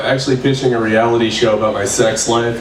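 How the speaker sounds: ordinary talk, nothing marked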